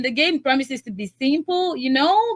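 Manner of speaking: ordinary talk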